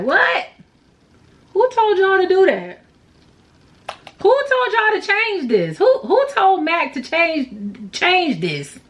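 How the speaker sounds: high, incredulous outrage; indignant rant. Real, not acted. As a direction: demand answers from the brand. Ranting about the new packaging.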